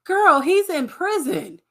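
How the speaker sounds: slow, high, pointed scoff; incredulous. Real, not acted. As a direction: spell it out for her slowly. Friend scoffing.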